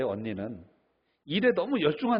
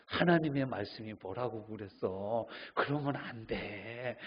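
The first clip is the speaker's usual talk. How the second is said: slow, softer preachy rebuke; stern scolding; 5 semitones lower, 5 dB quieter, slower than usual. Retold or imitated. Church elder scolding a weary member, slow stern rebuke. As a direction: lecture them slowly like a stern elder. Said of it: "그럼 우리는 대부분 뭐라고 합니까".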